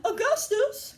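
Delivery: high-pitched motherly call; high, scolding call. A narrator's reading. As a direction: call his name sharply across the room. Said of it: "called out Missus Gloop".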